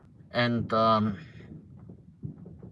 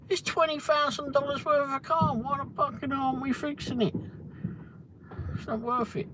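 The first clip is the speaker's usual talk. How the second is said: mock indignant pitch; 14 semitones higher, 4 dB quieter than usual. Retold complaint to sales manager.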